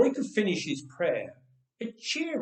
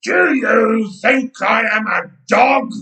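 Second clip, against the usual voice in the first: slow, loud, high roaring shout; giant's roar; 7 semitones higher, 14 dB louder than usual. Acted. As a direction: roar it so the ground shakes. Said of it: "he roared".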